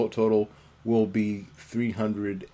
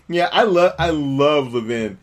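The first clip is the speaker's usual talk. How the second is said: normal talking voice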